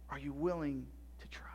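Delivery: breathy whispered question, barely any voice. Real, not acted. Pastor asking the fathers again, in a whisper. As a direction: lean in and whisper the challenge.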